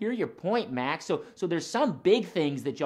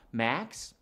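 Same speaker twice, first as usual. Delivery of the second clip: ordinary delivery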